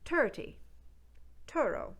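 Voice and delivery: Newfoundland "Turty", "turo" with a hard T for th; Newfoundland accent, th turned to T. Shown as a demonstration. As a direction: stop the th as T, Newfoundland style. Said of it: "those T H sounds will become T".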